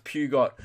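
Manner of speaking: the spelling-led pew-got for Peugeot. Retold or imitated. Quoting fans who shared his mistake, Peugeot said pew-got. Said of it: "I also thought it was pronounced".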